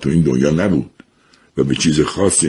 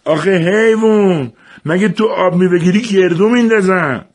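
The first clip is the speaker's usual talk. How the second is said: father's shout. A narrator's reading. Father bellows at Jafar over the broken juicer, furious. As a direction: bellow the insult then scold. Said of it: "بابا داد زد".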